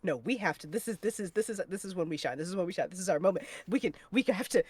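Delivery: very low, hushed, halting mumble; muttered self-pep-talk. Acted. Telling herself this is our moment, hushed low muttering. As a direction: talk yourself into it under your breath.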